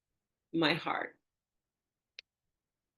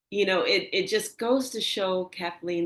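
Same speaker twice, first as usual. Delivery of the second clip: ordinary talk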